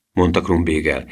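ordinary delivery

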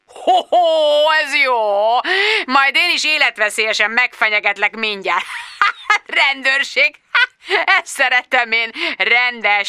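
high laughing boss, mocking; laughing mockery. Richard Finch laughing at Bridget's police story. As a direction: cackle at the idea of police.

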